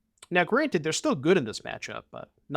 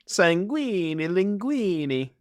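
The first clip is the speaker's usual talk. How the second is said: slow, sing-song mock Italian accent, a bit higher; 5 semitones higher, slower than usual. Shown as a demonstration. Streamer rhyming the card name in a mock Italian accent. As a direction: ham up a cartoon Italian accent. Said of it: "I gotta work on my Italian accent".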